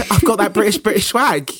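mock British swagger. Mock performance of the song British Swag.

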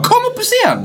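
high-pitched excited call. Retold or imitated. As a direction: call them up onto the stage. Acts calling people onto the stage.

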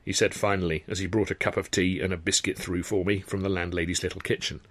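plain reading voice

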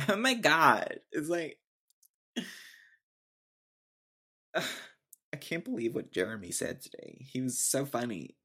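mocking Valley Girl gushing; Valley Girl accent. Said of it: "don't talk in that accent".

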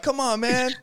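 high, slow coaxing plea; high teasing coax. Retold or imitated. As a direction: wheedle him like a tempter. Teasing peer pressure quote.

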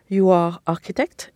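ordinary delivery

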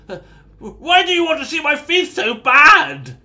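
panicked high yelp; startled prisoner crying out; scared. Acted. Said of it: "looking quite scared now, shaking almost".

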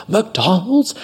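high-pitched, outraged MCDONALD'S with rising end; mock outrage. Angry customer repeating waiter's fast-food job, outraged. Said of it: "she got mad at me".

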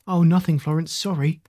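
ordinary delivery

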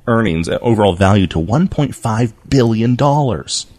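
normal talking voice

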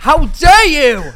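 drawn-out hammed-up indignant cry; mock outrage. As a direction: play the offended fan, big and slow. Mock outrage at Olivia insult.

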